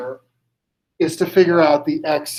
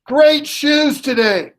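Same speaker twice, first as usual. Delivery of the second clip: higher, drawn-out voice out of nowhere; mysterious voice; 7 semitones higher, 3 dB louder, slower than usual. Acted. Joke's mystery voice compliments a man in the bar, slow and high. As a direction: stretch each word slowly, pitched up. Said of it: "Here comes his voice again".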